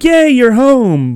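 high, loud, slow squeal of delight; talking puppy greeting its owner, overjoyed. Pretend. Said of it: "he's like a puppy that can talk".

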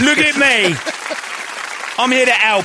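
high, eager British rocker voice; Jagger. Acted. Orderly Jagger answering a cleanup call, high eager rocker voice. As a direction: jump to it in a high, keen voice. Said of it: "Orderly Jagger".

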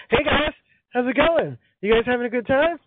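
high-pitched, chirpy blue crayon greeting the gang; cheerful new crayon. Acted. New blue crayon hailing the crayon box, chirpy and high. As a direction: voice an upbeat little crayon character, up high. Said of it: "just be like".

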